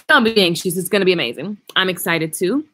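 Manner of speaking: ordinary talk, nothing marked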